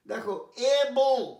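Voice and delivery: slow, loud, careful এবল; teacher pronouncing. Shown as a demonstration. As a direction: say এবল slowly and clearly. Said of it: "উচ্চারণটা কার". (শিক্ষকের স্পষ্ট উচ্চারণ)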